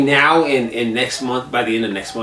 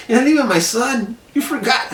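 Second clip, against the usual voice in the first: high-pitched, hammed-up hurt; retold mock indignation; 11 semitones higher than usual. Dad to son who forgot birthday, mock indignation. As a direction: act out a dad playfully disowning his kid.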